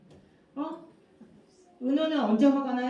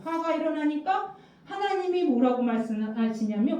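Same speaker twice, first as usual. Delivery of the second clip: usual speaking voice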